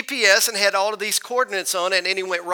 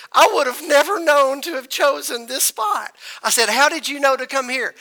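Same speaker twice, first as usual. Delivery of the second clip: higher, marvelling at the hidden spot; amazed wonder; 6 semitones higher than usual. Retold or imitated. Asking the old fisherman how he knew the spot, amazed and higher. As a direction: let the amazement lift the voice.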